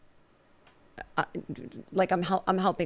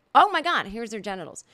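mock shock; 4 dB louder than usual. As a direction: exclaim it in playful shock. Acts out viewer's first shocked look.

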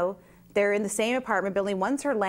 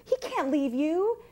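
higher pleading fan voice; worried fans; 9 semitones higher than usual. Retold or imitated. People on the street begging her husband not to leave, pleading higher. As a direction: plead like a fan who's hooked. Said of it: "they're like".

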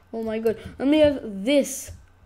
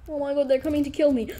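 ordinary talk

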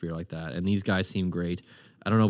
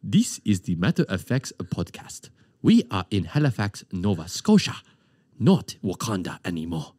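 Wakanda accent; 3 semitones lower than usual. Shown as a demonstration. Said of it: "I can do, like, a Wakanda accent".

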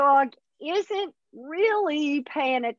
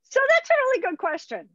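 bright praise; 11 semitones higher, faster than usual. Real, not acted. Teacher praising a question.

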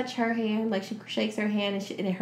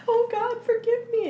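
high-pitched imploring cry to heaven; hammed-up begging for forgiveness; mock desperate plea; 13 semitones higher than usual. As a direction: plead much higher than your usual voice. Acted plea to God.

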